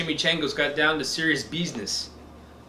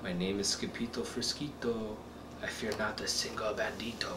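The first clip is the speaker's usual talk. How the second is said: much softer, barely there; 4 semitones lower, 11 dB quieter than usual. Acted. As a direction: say it very, very softly. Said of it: "muy, muy soft voice he said".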